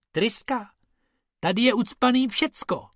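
high-pitched mock disbelief; Bob scoffing. Bob scoffs at Bobek over the sand-filled engine, high. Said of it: "řekl Bob".

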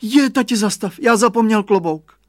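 put-on boy crying out to dad he forgot his hat; exclaiming. A narrator's reading. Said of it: "vykřikl". (chlapec vykřikne, mnohem výš a hlasitě)

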